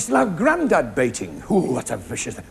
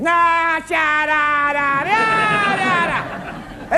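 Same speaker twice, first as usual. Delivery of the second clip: high mock-commentator gibberish; Eddie Waring babble; 7 semitones higher, much slower than usual. Comedian mimicking Eddie Waring in nonsense syllables. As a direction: babble like an excited commentator, pitched up. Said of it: "That's Eddie Waring".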